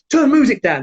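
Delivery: imitating a shouted demand; calling out. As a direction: call across a club to the DJ. Drunk brother orders DJ, retold.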